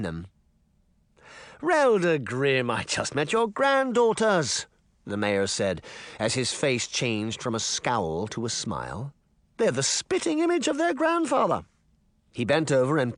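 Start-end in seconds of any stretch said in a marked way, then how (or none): 1.6-4.7 false charm. A narrator's reading. Mayor fake friendliness. 9.6-11.6 high sugary false compliment; fake sweetness. A narrator's reading. Mayor flattering the grandmother with false sweetness.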